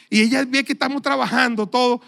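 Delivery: normal talking voice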